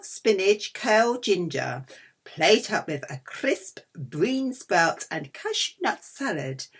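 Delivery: ordinary talk, nothing marked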